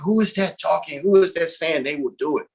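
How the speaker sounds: ordinary delivery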